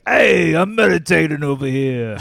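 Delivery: mock New York tough guy, shouting loud. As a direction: bark it like a cranky New Yorker at the temple. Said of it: "and they were like".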